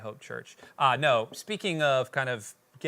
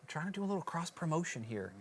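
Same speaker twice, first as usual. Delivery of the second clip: mock stage whisper aside; theatrical hushed aside; 5 semitones higher, 8 dB quieter than usual. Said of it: "This is my stage whisper for cross promotion".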